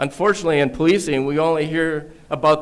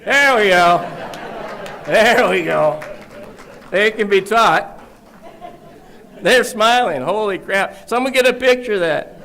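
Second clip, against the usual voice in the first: delighted; 7 semitones higher than usual. Real, not acted. Speaker thrilled the stiff cadets finally smile, laughing. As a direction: burst out in happy surprise.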